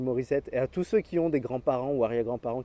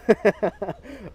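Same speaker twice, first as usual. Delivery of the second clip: amused laugh; 5 semitones higher, 5 dB louder than usual. Real, not acted. Laughs at the name [name].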